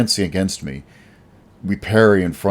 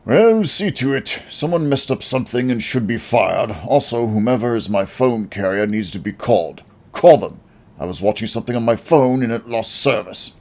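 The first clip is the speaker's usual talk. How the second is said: higher pompous rich man grumbling at his servant; pompous rich boss; 5 semitones higher, 3 dB louder than usual. Acted. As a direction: whine orders like a spoiled tycoon. Spoiled rich boss complaining.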